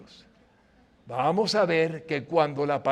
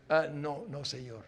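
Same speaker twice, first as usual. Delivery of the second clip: ordinary delivery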